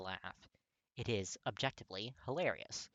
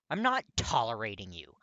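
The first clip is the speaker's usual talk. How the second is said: much louder, curt retort; snapping; 12 dB louder than usual. A narrator's reading. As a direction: snap back, frustrated. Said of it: "Katsuki snaps".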